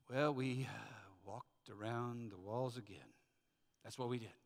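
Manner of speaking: reluctant, deflated report with a hesitation; sheepish husband. Acted. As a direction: drag out the embarrassing answer. Husband's day-three answer to wife.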